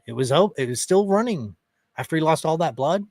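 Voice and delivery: normal talking voice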